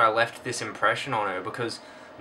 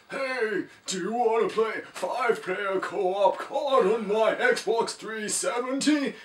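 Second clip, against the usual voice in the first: much higher, slow mock homeless guy; silly stranger voice; 11 semitones higher, slower than usual. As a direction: squeak it out like a goofy stranger.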